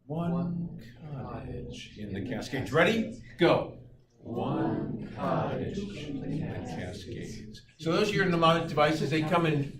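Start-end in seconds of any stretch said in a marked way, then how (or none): 0.0-2.7 relaxed tone. Shown as a demonstration. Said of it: "I'd like you to say". 4.2-7.6 soft, very slow sigh of relief; relaxed tone. Shown as a demonstration. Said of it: "say in a relaxed tone of voice".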